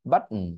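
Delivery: "But" with T held back, then "উম"; "But" choked off into a nasal "উম"; stopped T demo. Shown as a demonstration. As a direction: stop breath on "But", hum the n. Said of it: "stop করলাম".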